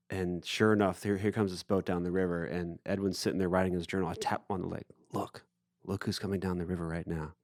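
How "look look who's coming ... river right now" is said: low, much softer aside; retold hushed alert. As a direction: quietly point someone out to a friend. Nudges friend at seeing the star.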